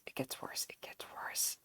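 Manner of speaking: hushed whisper. Real, not acted. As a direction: whisper it gravely. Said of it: "whispered aside about voice".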